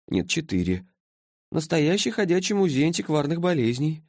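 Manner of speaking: drowsy, drawn-out creature voice; sleepy mumbling. A narrator's reading. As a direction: drone through the list, stretching the endings. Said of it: "А сонный голос всё бормотал".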